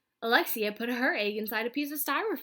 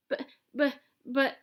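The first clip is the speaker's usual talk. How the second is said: stammering; 5 semitones higher, 3 dB louder than usual. Acted. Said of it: "said the snowman and Mister Docker".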